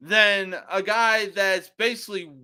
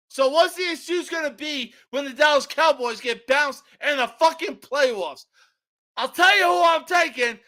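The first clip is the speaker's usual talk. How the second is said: ranting outburst; 8 semitones higher than usual. Real, not acted. Host ranting about the Cowboys' excuses, heated shout. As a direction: fire it off like a fed-up fan. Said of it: "I apologize for my outburst".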